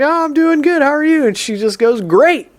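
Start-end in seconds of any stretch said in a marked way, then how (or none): 0.0-1.3 bright greeting. Retold or imitated. Retold greeting with neighbor. 2.0-2.5 enthusiastic. Retold or imitated. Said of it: "I was not expecting that".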